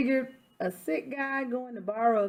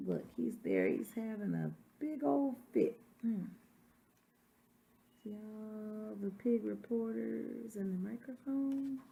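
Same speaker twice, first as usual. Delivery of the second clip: quiet aside; 8 dB quieter than usual. Real, not acted. Aside showing picture.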